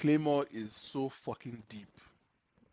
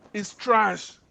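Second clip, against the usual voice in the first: higher, louder verdict; mock exasperated; 8 semitones higher, 7 dB louder than usual. Arguing with Kerry about One Piece, louder exasperated verdict. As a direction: slam it down as a final verdict. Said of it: "I said".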